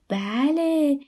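happy. A narrator's reading. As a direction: answer brightly like a proud mother. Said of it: "ریحان خاتون با خوشحالی گفت".

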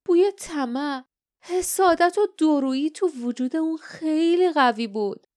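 high-pitched villain musing to himself; regretful scheming guardian. Acted. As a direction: muse over a lost victim with theatrical regret.

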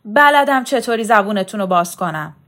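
loud, angry warning; roaring threat. A narrator's reading. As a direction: bellow to force them to talk. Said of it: "برزو نعره زد". (نعرهٔ تهدیدآمیز)

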